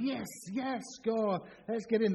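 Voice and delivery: high-pitched celebratory cheer without full volume; mild mock shouting. Angels bounding down to celebrate, excited mock shouting. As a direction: play excited fans, holding back the volume. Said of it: "and they're shouting".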